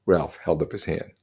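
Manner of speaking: plain reading voice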